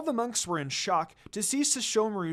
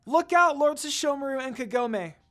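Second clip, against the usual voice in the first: high, loud warning shout; crying out a warning; 9 semitones higher, 6 dB louder than usual. A narrator's reading. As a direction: yell to warn them of danger. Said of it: "Rin cried out".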